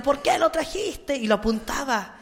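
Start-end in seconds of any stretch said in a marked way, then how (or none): 0.0-1.2 high, slow demonic accusation; possessed girl's eerie cry. Retold or imitated. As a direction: point and accuse like the possessed girl. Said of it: "le decía".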